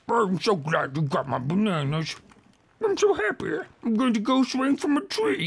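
high, put-on gleeful monkey; happy monkey voice. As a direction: cheer like a monkey. Monkey thanks player.

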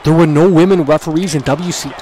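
loud indignant rant; shouting at the referee on screen; outraged shout. Real, not acted. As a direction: yell it at the screen in disbelief. Ranting at wrestling game.

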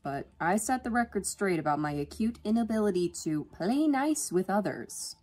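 ordinary delivery, nothing marked

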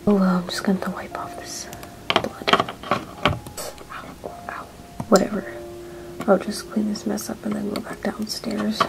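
hushed pained whisper. Acted. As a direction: whisper to herself while hurt. Hurt, alone, muttering to herself.

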